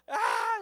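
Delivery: high imitated infant wail; high-pitched mock "aah"; mock baby cry.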